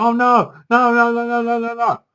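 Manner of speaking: high, sustained string of panicked no's; mock panic. Retelling the helpless men's reaction, mock panic.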